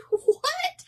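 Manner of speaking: squeaky high what; a very high incredulous yelp; surprised. Real, not acted. Reacting to her husband's ban, high and incredulous.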